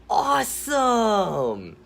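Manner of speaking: slow, high, loud awesome; drawn-out cheer. Acted. Boy cheering about the wolves, slow high cheer. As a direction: stretch it out in pure delight.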